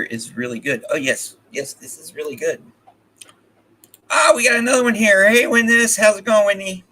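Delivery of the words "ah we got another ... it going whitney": loud, high, rapid welcome; real excited shout-out to an arriving viewer; excited greeting. Real, not acted. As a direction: burst out greeting a newcomer, fast and loud. Greets viewer joining the stream.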